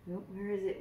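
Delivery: plain reading voice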